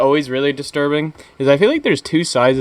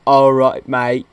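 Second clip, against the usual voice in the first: louder mock-British greeting; bad British accent; 4 dB louder, slower than usual. Retelling a friend's prank call, clumsy British accent. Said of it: "he was doing one".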